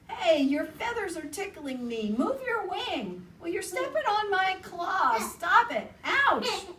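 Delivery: high, loud squabbling voices; squabbling animals. Acted. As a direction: squeal and complain, half laughing.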